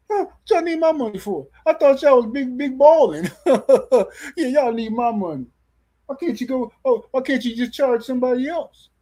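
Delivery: very high, incredulous whine; ridiculous mock protest. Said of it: "This is how ridiculous A J fans sound".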